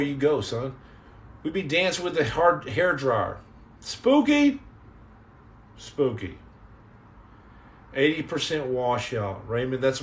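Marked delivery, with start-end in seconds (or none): 3.8-4.6 Spooky? asked high with a sharp rising lift; Spooky? pitched way up like a question. Shown as a demonstration. Reading a viewer's word aloud, high and questioning. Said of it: "reads out a viewer comment". 5.8-6.4 Spooky. dropped low and soft, a spooky deadpan; Spooky. said low and hushed with an eerie tone. Shown as a demonstration. Answering his own question, low spooky deadpan. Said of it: "repeats the comment word".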